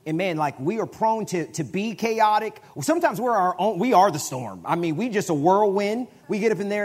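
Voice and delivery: high, loud preacher build; full-force preaching. Real, not acted. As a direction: whip the room up like a storm.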